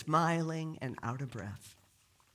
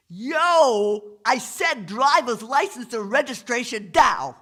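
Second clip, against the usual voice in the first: louder, higher New York bellow; cranky cop shout; 8 semitones higher, 11 dB louder, slower than usual. Acted. Cranky cop jolts the stoned driver, shouting. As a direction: bellow it, out of patience. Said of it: "Cop one's getting crankier".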